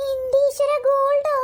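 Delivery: oh my god, gold?; amazed gasp. Acted. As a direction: blurt it out, stunned by the gold. Mother amazed at gold gift.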